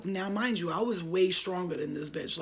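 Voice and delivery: usual speaking voice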